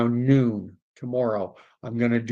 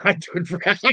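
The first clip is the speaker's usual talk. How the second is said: laughing through the words, then a high laugh; 6 semitones higher, faster than usual. Real, not acted. Laughing at a chat offer of half the money.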